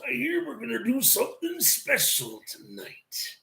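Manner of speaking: slow whispered Macho Man; hint of a hushed Macho Man. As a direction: lean in and rasp it like Randy Savage. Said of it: "Macho Man comes up and says".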